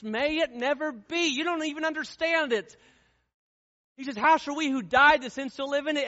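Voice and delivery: fiery pulpit delivery; full-force preaching. Acted. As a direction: preach it out, high and hard.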